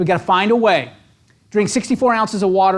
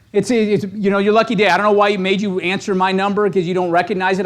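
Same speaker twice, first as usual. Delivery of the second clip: normal talking voice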